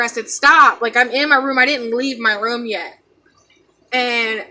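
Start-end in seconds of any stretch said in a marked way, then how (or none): 0.3-0.8 loud, abrupt retold command; retold scream. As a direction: call it out like a contained scream. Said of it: "I screamed at her".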